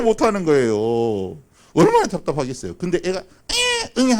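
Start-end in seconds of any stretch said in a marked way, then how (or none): 3.2-4.2 high-pitched mock baby wail; squealed infant cry; newborn's cry. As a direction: imitate a baby's first squeal at birth. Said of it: "하고 태어났어".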